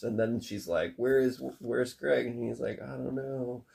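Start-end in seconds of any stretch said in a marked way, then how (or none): 2.8-3.6 soft, faint I don't know; quiet, dazed answer. Retold or imitated. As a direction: mumble it, defeated. That's what happened first.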